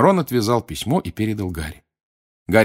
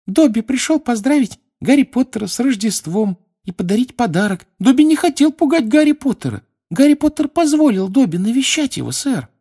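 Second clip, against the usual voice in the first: squeaky elf voice; 6 dB louder than usual. Acted. Dobby voiced high.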